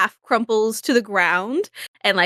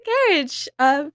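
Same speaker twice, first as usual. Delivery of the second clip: much higher, breathless ladylike thanks; flustered Izzy; 4 semitones higher than usual. Acted. Izzy to Benny, after the check-up, high flustered thanks. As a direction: thank him sweetly, flustered.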